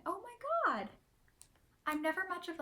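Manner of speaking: high delighted squeal; excited squeal. Real, not acted. Squeals over the glasses.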